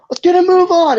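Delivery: high-pitched acted yell. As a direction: urge them on with a mock shout. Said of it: "he yells to the people".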